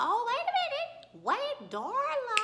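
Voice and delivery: high, loud, laughing disbelief; scandalized outburst. Retold or imitated. Mock-shocked reaction to the scene.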